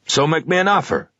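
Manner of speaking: a hint of a pushy shop clerk; pawnshop salesman voice. A narrator's reading. Assistant haggling.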